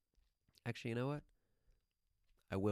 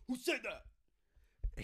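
high, drawn-out mock-angry demand; stretched, high-pitched comic indignation; imitated pissed-off outburst; 14 semitones higher, much slower than usual. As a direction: mimic an outraged singer, pitched way up. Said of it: "He gets so pissed off".

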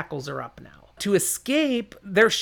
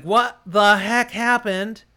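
dazed confusion; 5 semitones higher, 6 dB louder, slower than usual. Acted. Dazed after blast.

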